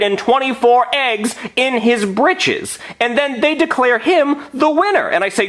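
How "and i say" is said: usual speaking voice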